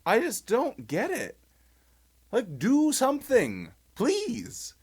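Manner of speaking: high-pitched, agitated plea; getting carried away with frustration; worked-up exasperation. Real, not acted. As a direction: plead in exasperation, voice pushed up high. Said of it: "I'm getting excited".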